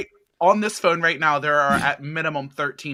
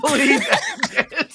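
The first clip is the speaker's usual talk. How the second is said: grandma voice; 10 semitones higher, 3 dB louder, slower than usual. Acted. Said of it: "that grandma voice".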